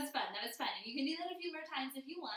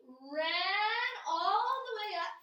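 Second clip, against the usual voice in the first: slow, higher, stretched-out words; drawn-out storytelling; 4 semitones higher, 5 dB louder, slower than usual. Acted. Teacher telling kids of the dog running, drawn-out storytelling. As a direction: stretch the run all the way up.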